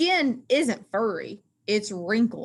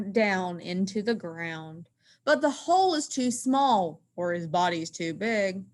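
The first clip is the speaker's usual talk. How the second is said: usual speaking voice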